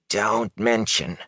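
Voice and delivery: slow, hushed rasp; hoarse, hushed. A narrator's reading. Peddler warning the young man in the alley, hoarsely hushed. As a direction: draw it out as a wary warning. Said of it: "dropped into a hoarse whisper".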